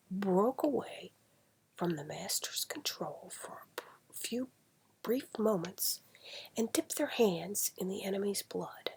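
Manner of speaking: ordinary delivery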